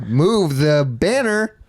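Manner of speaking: big slow reveal shout to the audience; mock TV reveal. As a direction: announce it like a makeover-show host. Reveal the beer pour.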